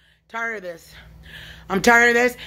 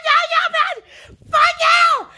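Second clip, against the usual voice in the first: crying shout. Real, not acted. Lashing out at the voices, crying shout. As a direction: yell it through tears. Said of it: "This yelling and screaming".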